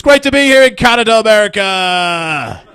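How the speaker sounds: hammed-up greeting to a New York crowd; mock stage greeting. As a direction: hurl it to the crowd like a rock star.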